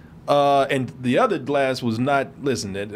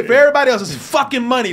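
emphatic punchline; 8 semitones higher, 7 dB louder, faster than usual. Acted. Contrasting rich and ordinary people.

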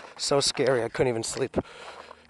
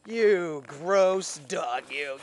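very slow, drawn-out and raised in pitch; disgusted scolding; much slower than usual. Real, not acted. Owner telling off a gross dog, slow disgusted voice. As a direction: drag the words out in disgust.

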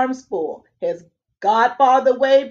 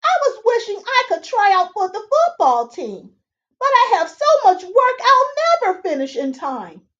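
put-on higher boyish voice, earnest; lightly higher pleading boy; young Sidney; 9 semitones higher, 4 dB louder than usual. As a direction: protest like an eager kid. Sidney answers the fairy.

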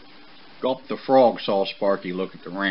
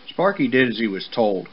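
usual speaking voice

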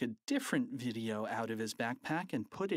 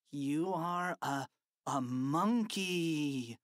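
mock hypnotist; 3 semitones higher, much slower than usual. Boy hypnotizing principal.

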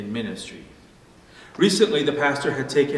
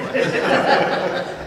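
a burst of breathy laughter; real laugh at the punchline; 7 semitones higher, 5 dB louder than usual. Real, not acted. Pastor laughing at own joke to congregation, breathy burst.

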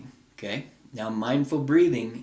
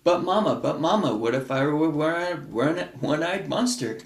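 put-on worried child; 4 semitones higher than usual. Child in the book asking mama a worried question, higher pleading voice. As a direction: play the anxious child, stumbling on were.

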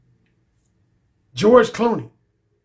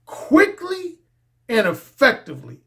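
quickly pitched up high, effectively dropped and firm; emphasis on quickly and effectively; 4 semitones higher than usual. Shown as a demonstration. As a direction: lean hard on quickly, then and effectively. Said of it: "emphasis on those two words".

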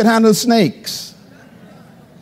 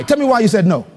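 normal talking voice

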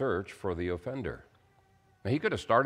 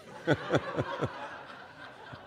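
breathy laugh; 8 semitones higher, 5 dB quieter than usual. Real, not acted. Punchline of joke.

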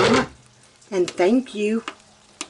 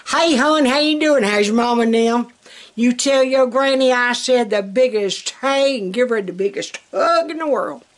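folksy Southern greeting; 3 semitones higher than usual. Retold or imitated. Recalling how folks back home greeted her, warmly gushing. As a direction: gush it out like a sweet old neighbor lady. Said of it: "That's what people would say to us".

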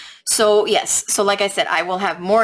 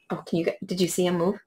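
usual speaking voice

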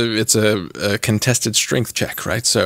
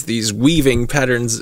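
ordinary delivery

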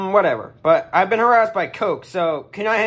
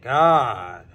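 ordinary delivery

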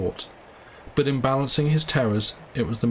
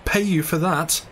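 startled, pitch jumping up on you; a sudden disbelieving cry; cried out; 6 semitones higher than usual. Acted. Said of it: "he cried".

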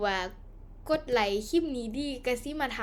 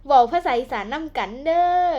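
loud Isan-dialect title call with เด้อ; 9 dB louder than usual. Shown as a demonstration. Host announcing her Isan series title to viewers, in loud Isan dialect. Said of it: "การสอนภาษาอีสาน".

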